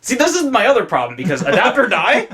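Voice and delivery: laughing through the words. Real, not acted. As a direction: chuckle while you admit it. Laughing at own admission.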